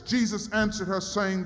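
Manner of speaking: normal talking voice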